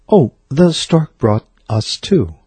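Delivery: slow, a hint of a prim mother fibbing; mother repeats the stork fib, slow and a touch uneasy; squirming mother. A narrator's reading. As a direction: drag it out like a mildly uneasy mother. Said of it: "by now starting to squirm a little".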